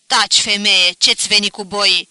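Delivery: husband silences his wife at the coffin, angrily; grinding out. A narrator's reading. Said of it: "scrâșnind".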